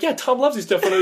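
high, nervous and placating; awkward high voice. Acted. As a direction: squeak it out awkwardly.

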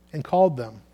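usual speaking voice

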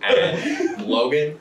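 slow, hushed suspense narration; dramatic narration. Acted. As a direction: draw out each beat for tension. GM narrates tense scene.

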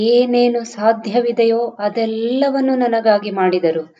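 ordinary delivery

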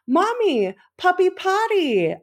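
mother imitating her son explaining puppy's turn; toddler voice. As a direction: sound out each word like a small child. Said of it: "he's like".

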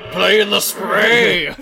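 high, loud, slow sing-song cry; mock villain cry. Mocking the ad slogan, high loud cartoon yell. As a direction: belt it out like a cartoon baddie.

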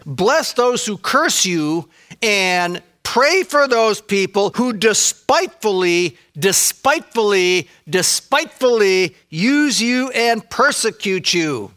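slow, solemn King James wording; King James reading. Acted. Quoting the King James to the congregation, slow and solemn. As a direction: read it out slowly like old scripture. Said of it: "let me quote the King James there".

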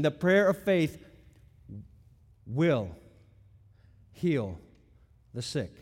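long pauses isolating "will" and "heal"; "will" and "heal" dropped low, each standing alone; deliberate word-by-word weight on "will heal"; emphatic. Shown as a demonstration. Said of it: "That's emphatic language".

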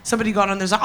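usual speaking voice

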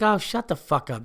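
offhand retort. Retold or imitated. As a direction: toss out a retold fed-up comeback. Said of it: "just like that".

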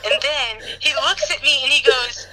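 ordinary talk

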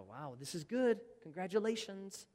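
make-believe impressed congratulations; mock congratulations. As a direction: pretend to applaud from the sidelines. Mock response of standing back.

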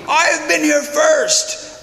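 high, drawn-out mock squabbling shout; imitated yelling. Italians fighting over places in line, high mock yelling. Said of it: "they start screaming and yelling at each other".

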